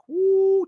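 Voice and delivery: excited whoop. Real, not acted. Reacting to Axe's return.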